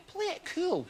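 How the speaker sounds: high, hushed voice in the head; inner voice. Acted. His brain telling him to play it cool, hushed. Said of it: "my brain went".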